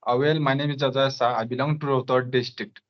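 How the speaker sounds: ordinary talk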